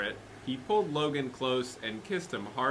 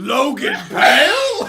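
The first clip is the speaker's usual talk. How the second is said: higher, louder, slow roar; 12 semitones higher, 11 dB louder, slower than usual. Shown as a demonstration. Co-reader roaring the name on request. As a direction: bellow the name slowly. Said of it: "you have to roar it".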